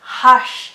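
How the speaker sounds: showing the sh in hush. Shown as a demonstration. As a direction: hush ending in a long hushed sh. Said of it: "Do you hear the S H sound here".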